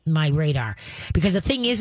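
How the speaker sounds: normal talking voice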